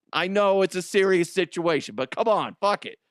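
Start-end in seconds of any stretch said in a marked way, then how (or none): none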